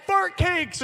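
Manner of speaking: put-on high, very loud kid's shout; brother's yell. Brother interrupting mom's text from the back seat, yelling. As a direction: shout it out to wreck the dictation. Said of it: "my brother would yell out".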